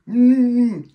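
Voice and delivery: loud high hum through sealed lips; closed-mouth mumble. Retold or imitated. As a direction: hum like your lips are glued shut. Mom with superglued lips.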